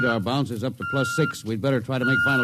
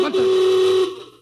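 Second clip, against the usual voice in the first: much higher and louder, cut off mid-word; jolted by a sudden alarm; captain cut off mid-toast by the alarm; startled shout; 6 dB louder than usual. Acted. Alarm interrupts celebration.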